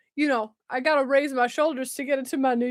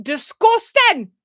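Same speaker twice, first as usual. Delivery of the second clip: loud, high, slow Scottish catchphrase; Scottish accent; 6 semitones higher, 7 dB louder, much slower than usual. Acted. Asking listeners where it's from, loud Scottish accent. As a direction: stretch it out in a Scottish yell. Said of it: "Do y'all know what my dis".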